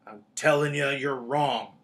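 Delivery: loud, heated Hagrid; hotly. A narrator's reading. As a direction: insist hotly in a booming voice. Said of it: "said Hagrid hotly".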